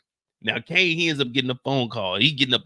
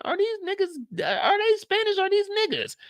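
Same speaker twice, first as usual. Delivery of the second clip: confused. Real, not acted. Confused bystander wondering aloud.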